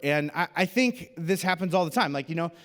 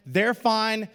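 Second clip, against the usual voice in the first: ordinary talk, nothing marked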